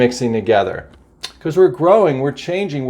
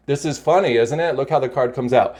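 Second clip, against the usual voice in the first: normal talking voice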